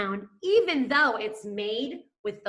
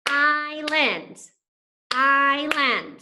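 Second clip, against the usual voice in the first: Island higher and louder, each beat distinct; syllables set apart; 5 semitones higher, 5 dB louder, much slower than usual. Shown as a demonstration. Teacher sounding out Island for the class by syllable. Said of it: "So we have our talking vowel".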